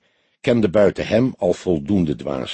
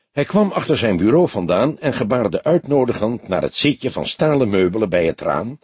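ordinary delivery, nothing marked

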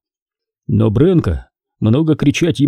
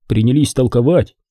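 ordinary delivery, nothing marked

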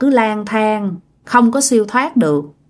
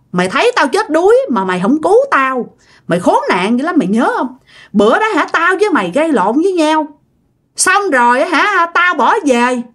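put-on higher, drunken grudge spat at an old friend; mock drunken accusation; 6 semitones higher than usual. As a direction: accuse him bitterly, like a drunk remembering a betrayal. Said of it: "Nó nói rằng đó là". (giọng nhại say xỉn trách móc, cao hơn)

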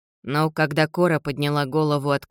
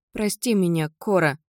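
plain reading voice

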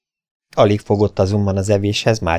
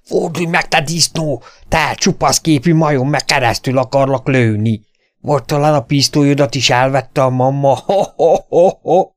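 higher, jeering shouted threats; raging bellow; 7 semitones higher, 4 dB louder than usual. Acted. Davy grabs his belt and howls threats at Derek. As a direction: scream insults and laugh in his face. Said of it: "úgy üvöltötte".

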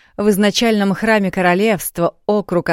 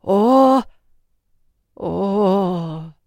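shocked gasp; 3 semitones higher than usual. A narrator's reading. Queen reads shocking news.

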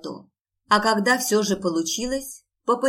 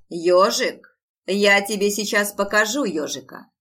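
loud mock-threatening dad; playful loud threat; 7 dB louder than usual. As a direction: roar it playfully at a child. Father playfully threatens daughter.

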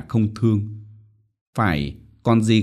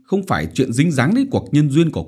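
fast, louder impatient snap; 6 semitones higher, 4 dB louder, faster than usual. A narrator's reading. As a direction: scold the stalling daughter. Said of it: "Mẹ nóng ruột gắt". (nóng ruột gắt)

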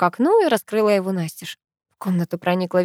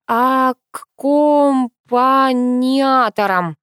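very slow, syllable-by-syllable, high and loud; laboriously sounded out; 6 semitones higher, 6 dB louder, much slower than usual. A narrator's reading. Sister struggles to pronounce a hard word, laboriously sounded out. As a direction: stretch every syllable of the difficult word. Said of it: "сейчас, погоди, выговорю".

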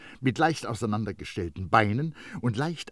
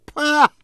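high, loud puff of breath; scornful pah; 12 dB louder than usual. A narrator's reading. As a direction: blow it all out in scorn. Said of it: "stieß dabei den ganzen Atem ... heraus".

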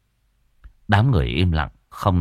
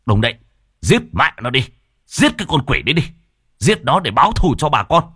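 higher, louder baying for blood; crowd clamoring; 7 semitones higher, 3 dB louder than usual. A narrator's reading. Villagers demand his death together, shouting. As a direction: whip up the mob's chant. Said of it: "mọi người đồng thanh".

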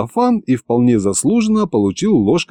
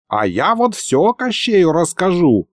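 tattling threat; 7 semitones higher than usual. A narrator's reading. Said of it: "пообещал Лиховид".

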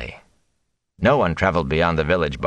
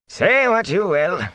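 slow, higher grumble; grumpy thatcher; 14 semitones higher, slower than usual. A narrator's reading. Thatcher objecting to the mayor, slow and grudging. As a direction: drag it out grudgingly. Sen voiced.

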